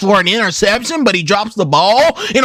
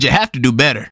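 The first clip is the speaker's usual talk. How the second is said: lower, stern coach's voice; 9 semitones lower, faster than usual. Retold or imitated. Coach scolding Bones after the game, stern low voice. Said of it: "Coach said".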